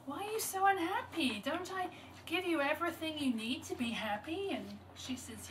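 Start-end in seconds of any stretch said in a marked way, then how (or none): 0.1-4.5 high-pitched, pleading question; storyteller voicing a troubled dog; puzzled dog's plea. A narrator's reading. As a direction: ask it high, wanting an answer. Dog questions the unhappy girl.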